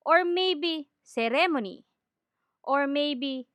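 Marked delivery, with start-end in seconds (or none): 1.0-1.9 "ceremony" stressed se-RE-mo-ni, full vowels; Filipino mispronunciation. Shown as a demonstration. Teacher lists commonly mispronounced words, "ceremony" as se-RE-mo-ni. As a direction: hit the second syllable of "ceremony". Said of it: "commonly mispronounced words".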